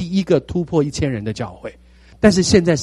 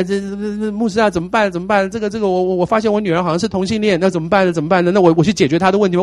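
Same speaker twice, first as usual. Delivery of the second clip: higher, fast flustered caller; stammering caller; 5 semitones higher than usual. Retold or imitated. A frantic caller telling the pastor about her daughter, flustered. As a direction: stutter and rush like a panicked parent.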